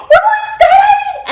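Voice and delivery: high, laughing squeal of joy. Retold or imitated. Girlfriend reliving the moment, squealing and laughing. Retold excited reaction.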